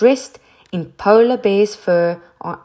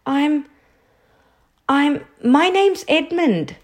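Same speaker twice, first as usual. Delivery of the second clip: put-on high, hesitant boy's reply; awkward stammered introduction; 8 semitones higher than usual. A narrator's reading. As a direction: falter and restart, pitched up. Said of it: "rather awkwardly".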